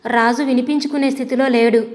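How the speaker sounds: usual speaking voice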